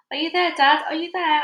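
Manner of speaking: high, loud searching call; shout. A narrator's reading. Child calling for Dad among the trees, shouting. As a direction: call out to someone out of sight. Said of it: "I shouted".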